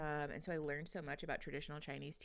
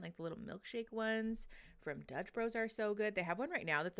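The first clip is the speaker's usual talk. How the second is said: usual speaking voice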